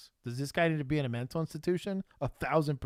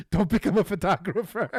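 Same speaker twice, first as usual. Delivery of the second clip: laughing through the punchline, louder and higher; 8 semitones higher, 9 dB louder than usual. Real, not acted. Joking to the co-host about careers, laughing. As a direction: crack up while delivering the joke.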